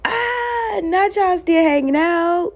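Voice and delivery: very high, loud squeal of ridicule; high mocking hoot. Real, not acted. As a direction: squeal it mockingly after a laugh. Hoots it again after laughing.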